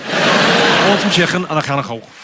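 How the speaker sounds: thick Scouse, k's rasped in the throat; broad Liverpool warm-up line; Scouse accent. Shown as a demonstration. Comic repeating the starter phrase, thick Scouse. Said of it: "the phrase I use to do the Scouse accent".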